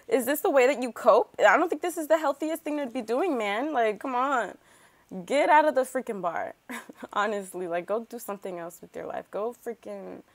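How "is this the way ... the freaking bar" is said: louder, higher, fed-up scolding; exasperated scolding. Real, not acted. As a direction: tell him off like a fed-up friend. Scolding the Sims character.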